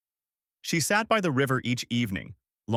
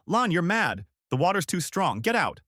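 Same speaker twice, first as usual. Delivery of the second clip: higher-pitched, restrained yell; mild yell; 6 semitones higher than usual. A narrator's reading. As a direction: warn urgently without much volume.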